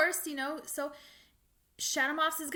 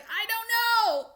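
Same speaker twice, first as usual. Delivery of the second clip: drawn out slow, much higher and louder; exasperated wail; 11 semitones higher, 7 dB louder, slower than usual. Retold or imitated. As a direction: moan it like you give up. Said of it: "and I was like".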